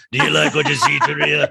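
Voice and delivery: gruff sailor voice, laughing at the end. Retold or imitated. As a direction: ham it up like an old sailor. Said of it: "I gave her a sailor voice".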